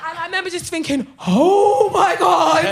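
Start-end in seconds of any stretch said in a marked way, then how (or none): 1.3-2.6 high, loud, long-drawn disbelief; shocked outcry. Retold or imitated. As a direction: stretch it out in comic shock. Acts her shock at being punched.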